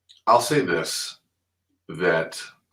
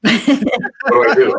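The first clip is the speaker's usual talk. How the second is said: much higher and slower, laughing through it; laughing panic; 9 dB louder, much slower than usual. Retold or imitated. Recalling going live the first time, laughing mock panic. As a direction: ask it laughing at your own panic.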